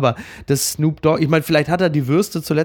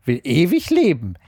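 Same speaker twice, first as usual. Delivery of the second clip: Homer Simpson. Acted. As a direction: read the column aloud as a comic character, dragging each phrase. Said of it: "mit so einer Homer Simpson-Stimme liest". (getragen vorgelesen mit komischer Zeichentrickstimme)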